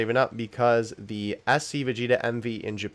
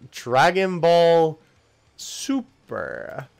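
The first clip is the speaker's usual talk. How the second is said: very slow, stretched syllables, pausing mid-title; drawn-out, baffled reading; 6 semitones higher, 5 dB louder, much slower than usual. Real, not acted. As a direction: draw each word out in disbelief.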